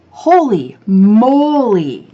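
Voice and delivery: slow, loud holy moly; drawn-out amazement. Real, not acted. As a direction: stretch it out in awe. Exclaiming about contest prizes.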